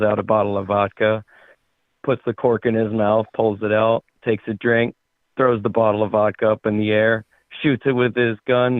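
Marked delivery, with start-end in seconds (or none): none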